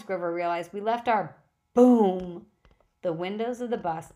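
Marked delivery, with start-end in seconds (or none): 0.7-1.4 louder, higher rising alarm; alarmed cry. Acted. Grover cries about the bags, loud and alarmed. 1.7-2.5 booming blast word; loud boom. A narrator's reading. Narrator bursts out the explosion, very loud.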